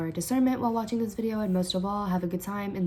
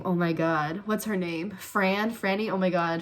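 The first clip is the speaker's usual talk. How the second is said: ordinary delivery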